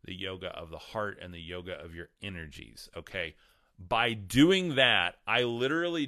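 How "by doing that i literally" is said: slow, strained, fighting off a sneeze; words pushed out around a sneeze; holding back a sneeze. Real, not acted. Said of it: "sorry, I'm trying not to sneeze".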